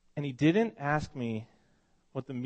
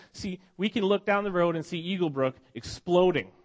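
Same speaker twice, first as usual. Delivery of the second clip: higher, louder, building sermon delivery; preaching at full force; 5 semitones higher, 5 dB louder than usual. Acted. Pastor preaching to his congregation about jealousy, full force. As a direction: lift the room and drive it home.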